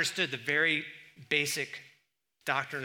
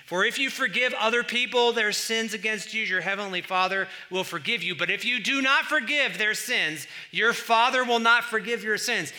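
loud, raised scripture reading; booming sermon voice; forceful preaching; 7 semitones higher, 8 dB louder than usual. Acted. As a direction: proclaim it like a warning.

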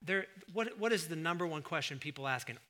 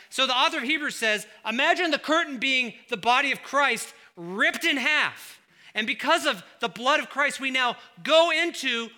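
full force preaching; 9 semitones higher, 11 dB louder than usual. Acted. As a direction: drive it home to the congregation.

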